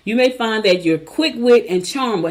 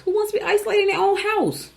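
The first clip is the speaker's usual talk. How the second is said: far higher squeaky rhetorical question; high mock question; 13 semitones higher than usual. Host teasing listeners with a high squeaky rhetorical question. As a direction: toss it up high, mocking.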